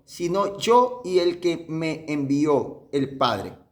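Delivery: very slow, spaced-out verse reading; unhurried scripture quote; slow, deliberate reading. Shown as a demonstration. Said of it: "bien despacio".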